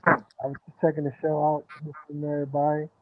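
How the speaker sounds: talking through a covered mic; muffled mic. Real, not acted. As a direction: hand over the mic. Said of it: "I think you covering the mic a little bit".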